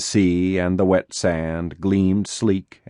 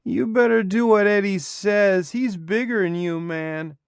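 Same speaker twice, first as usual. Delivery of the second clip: put-on high, slurred girl's voice; slurry voice; 13 semitones higher than usual. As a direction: drag the words together, slurring. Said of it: "in her slurry voice".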